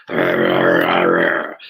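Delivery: very low repeated growls; imitated dog growling. Police dog refusing to release, growling. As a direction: growl like a dog that won't let go. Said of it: "and the dog going".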